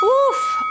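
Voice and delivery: sharp high woof of relief after effort; effortful woof exhale. Real, not acted. Exhale after a hard set.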